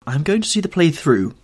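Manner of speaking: put-on bitter young man standing his ground; hard, bitter. A narrator's reading. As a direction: say it firmly, with bitterness. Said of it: "in a hard, bitter voice".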